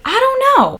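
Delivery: very high-pitched "no idea"; high squeal of cluelessness; clueless. Real, not acted. Clueless about which country it means.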